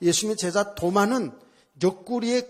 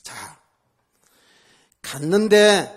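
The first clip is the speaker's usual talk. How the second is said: usual speaking voice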